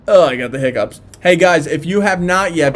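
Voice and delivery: ordinary talk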